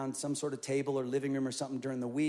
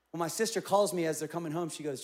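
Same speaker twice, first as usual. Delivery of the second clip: ordinary talk, nothing marked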